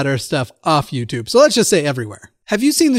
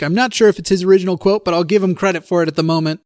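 normal talking voice

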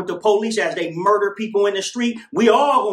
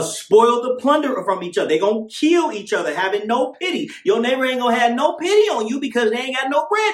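doomsday street preacher; 5 semitones higher than usual. Acted. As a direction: preach the end times at full force. Fiery preaching on prophecy.

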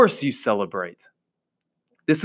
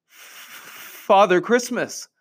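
slow, higher-pitched frightened answer; stammering fox; 7 semitones higher, much slower than usual. A narrator's reading. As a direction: stammer it out in fear, drawing it out. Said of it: "stammered the fox".

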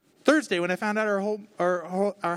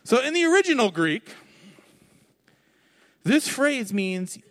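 pompous scholar; 4 semitones higher, 3 dB louder than usual. Shown as a demonstration. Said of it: "so I sound really smart".

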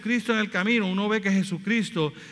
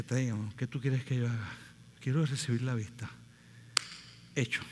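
gentle; 8 semitones lower, 10 dB quieter than usual. Retold or imitated. Jesus stops for the blind man.

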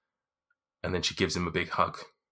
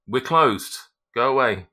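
loud, higher "go away"; gruff dismissal; 5 semitones higher, 8 dB louder than usual. Acted. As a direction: bark it through the door. Voice behind locked door.

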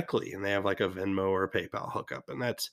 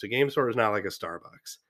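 usual speaking voice

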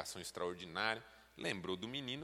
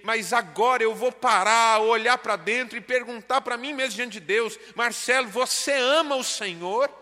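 building from firm to loud, high preaching; preaching at full force; 11 semitones higher, 16 dB louder than usual. Acted. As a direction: preach it, rising to a cry. Pastor preaching, building to climax. (pregação crescente, cada vez mais alta e aguda)

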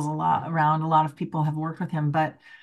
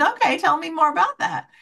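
gentle encouraging; 11 semitones higher, 3 dB louder than usual. Retold or imitated. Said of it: "So I said".

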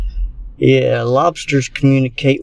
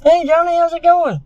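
falsetto friendly hey; chirpy high greeting voice; high-pitched casual greeting. Retold or imitated. Mimicking the guy again.

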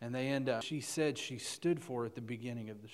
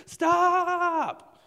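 high, drawn-out scream; screamed stop; 11 dB louder than usual. Retold or imitated. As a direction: scream it out long and loud. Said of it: "Just starts screaming out".

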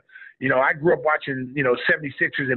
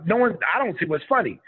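ordinary talk, nothing marked

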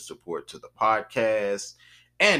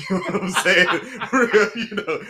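laughing; 9 semitones higher, 8 dB louder than usual. Real, not acted. Laughing while mocking Nikki's vanity.

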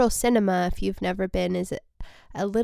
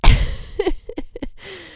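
airy laugh; 10 semitones higher, 6 dB louder than usual. Real, not acted. Laughs at own joke.

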